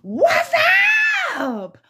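high stretched shouted greeting; calling out. Real, not acted. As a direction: holler it up high.

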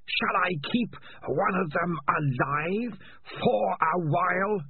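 slow, high and sinister; drawn-out mocking creature voice; eerie alien voice. Alien voice taunts.

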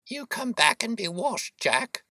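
high-pitched motherly shout. A narrator's reading. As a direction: call a runaway child back, pitch way up. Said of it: "she shouted".

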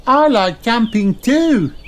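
put-on very high young kangaroo; slow, high, gleeful child voice; Kylie. A narrator's reading. Kylie joins the jumping game, high and eager. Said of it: "she says".

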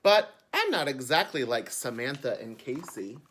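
bright storytime reading; children's book narration. A narrator's reading. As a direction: read it like a picture book to kids. Reads the book aloud.

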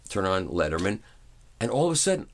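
normal talking voice

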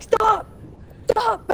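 high mock yell of stop; high pitched mock plea to stop; imitating friends yelling stop. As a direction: squeal stop like the panicked friends. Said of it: "they're just like".

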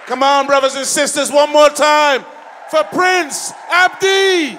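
high, booming call for applause; rally the crowd at full volume; MC shout. Real, not acted. Host asks crowd to applaud departing act, real rousing shout.

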